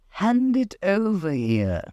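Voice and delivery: high, slow commanding bird; lordly dodo demanding. A narrator's reading. Dodo demanding the thimble, high and slow. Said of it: "said the dodo".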